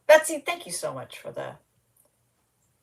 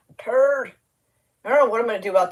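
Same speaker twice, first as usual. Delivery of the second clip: higher, louder goofy whine on tired; 4 semitones higher, 5 dB louder, much faster than usual. Pretend. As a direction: whine the word tired up high and loud. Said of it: "Y'all, I'm goofy tonight".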